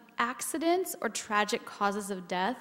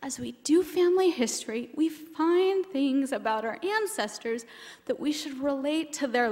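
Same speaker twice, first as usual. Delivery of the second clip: emotional, choked up; 5 semitones higher, 4 dB louder than usual. Real, not acted. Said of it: "I'm passionate and emotional about this".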